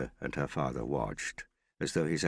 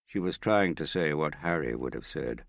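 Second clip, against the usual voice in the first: plain reading voice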